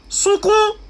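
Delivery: high, loud one-word order; shouted command. Real, not acted. Telling Maureen's mother to weep before pastors, shouted command. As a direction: belt it out as an urgent command.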